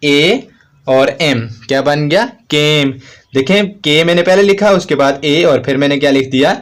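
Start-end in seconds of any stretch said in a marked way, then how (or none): 0.1-1.5 slowly spelled letters. Shown as a demonstration. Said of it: "ये देखें के".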